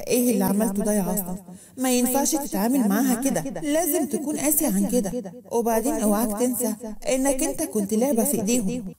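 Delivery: ordinary delivery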